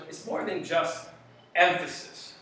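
ordinary talk, nothing marked

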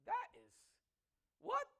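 high, incredulous what; stunned disbelief. Retold or imitated. Preacher voicing his own shock at the verse, high disbelief. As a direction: squeak it out in disbelief. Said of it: "I'm like".

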